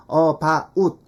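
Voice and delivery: "about" sounded out as "uh-ba-ut"; both vowels of "about" clearly separate, slow; full diphthong. Shown as a demonstration. Teacher showing the form to avoid, full diphthong. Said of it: "이게 아니고".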